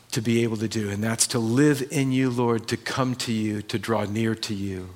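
calm, steady pastoral prayer. Real, not acted. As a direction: pray it quietly to the Lord. Closing prayer.

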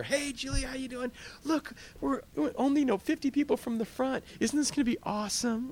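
a giddy believer chatting with Julie in line; excited believer. Acted. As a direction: gush to a friend in the heaven line.

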